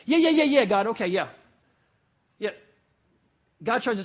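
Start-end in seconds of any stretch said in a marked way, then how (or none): 0.0-2.5 loud, rapid-fire yeahs dropping away; dismissive brush-off. Acted. Believer half-listening to God, dismissive and impatient. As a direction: hurry God along with impatient mock agreement. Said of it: "it's like almost how we treat God".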